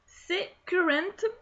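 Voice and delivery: slightly hammed-up English accent, high and slow. Real, not acted. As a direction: say it in a self-mocking English accent. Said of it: "avec mon superbe accent anglais".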